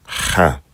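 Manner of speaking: Cha as a guttural, German-style ch plus ah; throaty cha. Shown as a demonstration. Teacher isolates the sound for learners, guttural Cha. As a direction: rasp out Cha from the back of the throat. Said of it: "So it's a cha sound".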